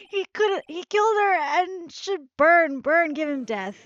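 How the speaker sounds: hammed-up courtroom fury; furious rant. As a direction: rage at the defendant. Witness ranting for death.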